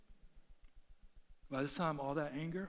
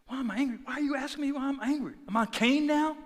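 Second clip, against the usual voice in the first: high, indignant mock retort; with an attitude; 10 semitones higher, 10 dB louder than usual. Retelling an angry retort to God, with an attitude. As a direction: snap back with acted attitude. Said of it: "With an attitude".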